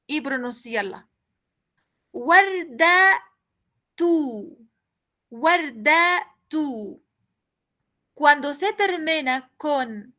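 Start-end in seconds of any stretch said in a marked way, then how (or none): 2.1-4.6 Guarda tú said as the Arabic warda-tu, syllable by syllable; Arabic word said slowly. Shown as a demonstration. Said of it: "voy a poner la vocal damma y pronunciarla". 5.3-7.0 Guarda tú drawn out very slowly as one word, warda-tu; slow Arabic demo. Shown as a demonstration. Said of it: "voy a poner la vocal damma y pronunciarla".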